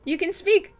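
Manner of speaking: high, loud, delighted greeting; excited. Real, not acted. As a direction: squeal with joy at a guest. Partner joins the stream.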